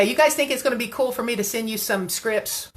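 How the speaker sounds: usual speaking voice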